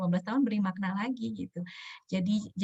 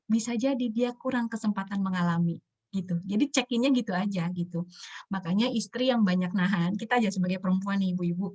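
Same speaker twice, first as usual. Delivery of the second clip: ordinary talk, nothing marked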